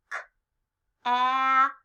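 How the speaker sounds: "K, ap" split after the K; lone "K", pause, drawn-out "ap"; stretched-out word. Shown as a demonstration. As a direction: sound out "K, ap" slowly for learners. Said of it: "Stretch out cap with me".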